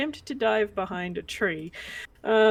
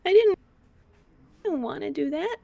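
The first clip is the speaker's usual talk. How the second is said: put-on higher, hesitant small-girl voice; a guilty child defending herself; wide-eyed little girl protesting; Missy the little girl; 5 semitones higher than usual.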